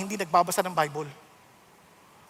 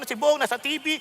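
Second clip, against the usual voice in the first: ordinary talk, nothing marked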